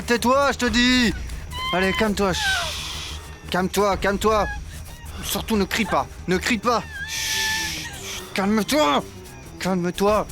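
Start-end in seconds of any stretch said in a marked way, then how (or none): 2.3-3.2 long hissed "shh"; urgent shush. Acted. Captor silences the victim, urgent shush. Hushes a captive not to cry out. 7.1-8.2 long hissed "shh"; urgent shush. Acted. Captor silences the victim, urgent shush. Hushes a captive not to cry out.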